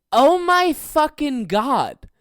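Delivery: loud, high, drawn-out disbelief; astonished outburst. Real, not acted. Comedian marvelling at a seventies movie scene, big astonishment. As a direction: stretch every word in loud amazement. Amazed how shocking in 1973.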